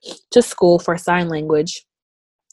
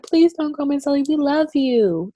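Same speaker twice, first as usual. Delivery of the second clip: mock pleading, higher childlike voice; pleading kids; 9 semitones higher than usual. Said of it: "They were like".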